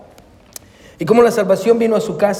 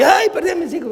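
mock panic; 9 semitones higher than usual. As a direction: act out a parent's old fright. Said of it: "Antes me asustaba y".

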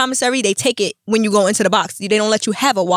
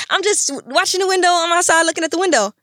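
her past self; 8 semitones higher than usual. Retold or imitated. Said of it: "I'm like".

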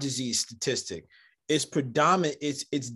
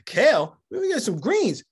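scoffing; 8 semitones higher, 5 dB louder than usual. Retold or imitated. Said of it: "mock scoffing kale".

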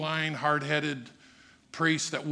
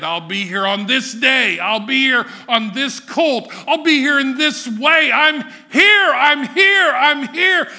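building louder and higher to a cry; Jesus' insistence; 9 semitones higher, 11 dB louder than usual. Acted. Jesus pleading with the city that ignores him, rising cries. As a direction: press it harder each time. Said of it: "even though he said".

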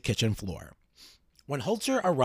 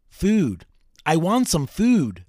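higher, louder plea, slowly drawn out; mock sobbing plea; 5 semitones higher, 8 dB louder, much slower than usual. A narrator's reading. Hungry ghost keeps begging for food, mildly imitated sobbing. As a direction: narrate a desperate cry without real sobs. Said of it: "the voice continued to sob".

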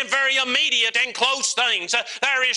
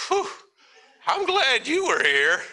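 relieved exhale, then higher pitch; mock relief after a close scare; acted relief; 5 semitones higher than usual. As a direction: let out a "whew" of relief. Acted relief after dogs stop.

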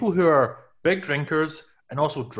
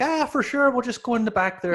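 a bad, much higher Irish old-man accent; 10 semitones higher than usual. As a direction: do a clumsy Irish pub regular. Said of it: "that was a terrible accent".

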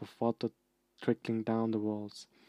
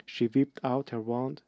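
usual speaking voice

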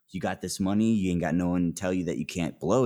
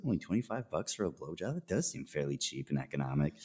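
inner thought; 3 semitones lower, 9 dB quieter than usual. Retold or imitated. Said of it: "sitting in my mind like".

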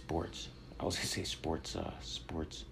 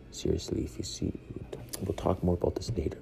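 usual speaking voice

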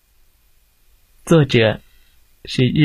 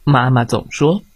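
usual speaking voice

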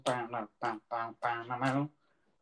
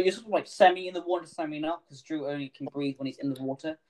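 ordinary delivery

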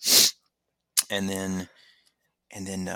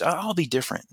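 protesting; 10 semitones higher, 4 dB louder than usual. Retold or imitated. Protest repeated.

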